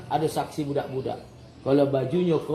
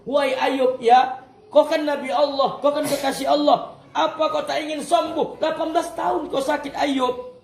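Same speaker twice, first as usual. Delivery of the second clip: questioner voice; 9 semitones higher than usual. Retold or imitated. Voicing a questioner to Ayub.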